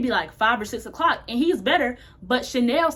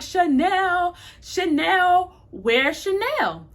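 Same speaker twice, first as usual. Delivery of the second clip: put-on crying drunk plea; 6 semitones higher, much slower than usual. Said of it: "picking him up and he's crying".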